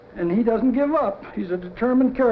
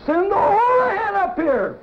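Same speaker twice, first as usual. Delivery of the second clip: bellowing order; 10 semitones higher than usual. Acted. Pompous president shouting for O'Houlihan through the intercom, yelling. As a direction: roar it through the intercom like a furious boss.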